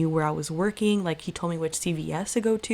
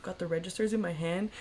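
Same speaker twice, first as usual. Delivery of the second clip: ordinary delivery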